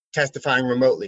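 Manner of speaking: ordinary delivery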